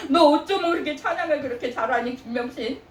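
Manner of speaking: high, laughing praise; God delighted. Retold or imitated. God marveling at a church member's singing, laughing. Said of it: "하나님이 감동하시는 거야".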